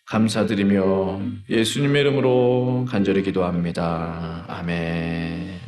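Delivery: low, settling end of prayer; solemn prayer closing. Real, not acted. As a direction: bring the prayer down to a drawn-out amen. Closes the prayer.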